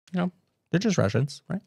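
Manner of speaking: usual speaking voice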